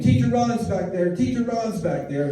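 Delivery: put-on high, insistent child voice; mimicked high-pitched little heckler; kid spouting off. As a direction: pipe it up like a tattling preschooler. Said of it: "he kept just spouting off my name".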